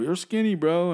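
put-on high, loud childish yelling; mock yell. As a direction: yell it like a bratty kid. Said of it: "just yelling over".

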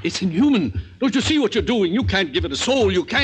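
high, loud, horrified outcry; angry protest. Acted. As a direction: shout him down in horror. Friend protests the experiment.

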